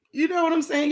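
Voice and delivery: talking through a yawn. Real, not acted. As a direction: say it while yawning. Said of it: "excuse me for yawning".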